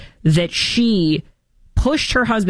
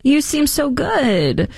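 high, slow, laughing fake praise; mock flattery; 8 semitones higher, slower than usual. Host mocks Kamala flattering Joe, laughing. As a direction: simper the compliment. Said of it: "she's trying to make them like".

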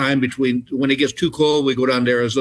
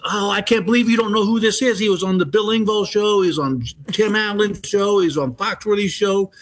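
higher-pitched, mock-indignant insistence; pitched-up gushing fan; retold incredulous fan; 7 semitones higher than usual. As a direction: imitate a fan listing credits in disbelief. Fan insists girl should know him.

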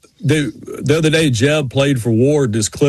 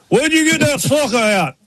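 Ward Burton; 10 semitones higher than usual. Retold or imitated. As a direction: stretch it out in a high mock-driver voice. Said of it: "Is Ward Burton. He goes".

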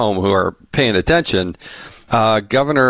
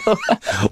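laugh. Real, not acted. Host laughs at the joke.

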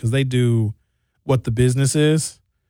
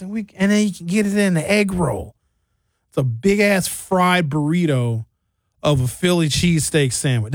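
ordinary delivery

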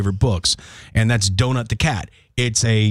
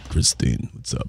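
much lower Rocky voice; Rocky impression; 10 semitones lower, 5 dB quieter than usual. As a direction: greet her as Rocky. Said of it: "Or your impression".

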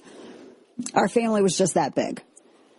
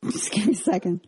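laughing; 2 semitones higher, faster than usual. Real, not acted. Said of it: "I'm dying".

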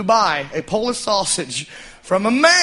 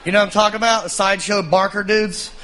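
usual speaking voice

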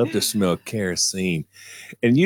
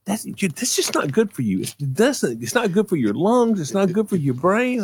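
higher, lightly voiced mother lecturing; a hint of a worried mother explaining; mom's lecture; 9 semitones higher than usual. Mother warning her son about his lungs, higher lecture. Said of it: "She says".

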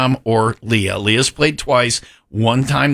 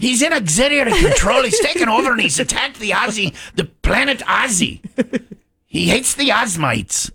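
much higher, urgent starship officer voice; Star Trek officer; 11 semitones higher than usual. Acted. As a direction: report to the captain like a panicked crewman. Star Trek crew report, acted.

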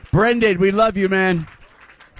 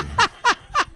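high burst of laughter. Real, not acted. Host laughs at guest's joke.

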